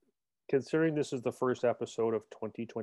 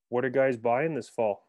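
ordinary talk

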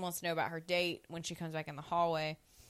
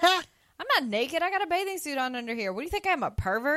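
high-pitched mock indignation; indignant protest; 8 semitones higher, 5 dB louder than usual. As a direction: retell it as an offended, rising protest. Retold indignant protest from bathtub.

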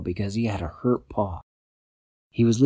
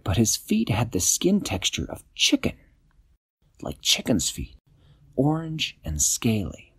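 usual speaking voice

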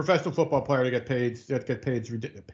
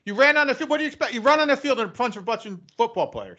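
higher, louder telling-off of the intruder; mock scolding; 8 semitones higher, 4 dB louder than usual. Scolding the fan who ran onto the field, raised voice. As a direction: scold him like a fool who asked for it.

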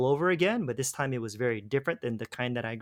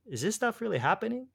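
higher, disbelieving question; incredulous; 8 semitones higher than usual. Retold or imitated. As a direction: ask it in stunned disbelief. Recalling shock at healing story.

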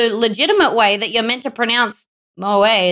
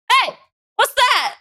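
mock yell; 12 semitones higher than usual. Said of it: "he yelled out".

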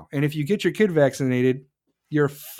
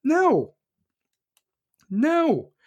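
scornful no; 10 semitones higher than usual. Acted. Rejecting the mocked excuse.

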